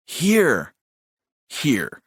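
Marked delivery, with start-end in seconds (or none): 0.1-0.7 high, falling, insistent word; insistent little brother. A narrator's reading. Brother insisting to his brother, high and impatient. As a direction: press the word in a raised voice.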